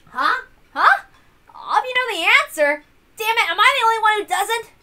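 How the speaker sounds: high, loud flustered whining; rising panic at being left behind; frantic panic. Acted. Battler alone not solving puzzle.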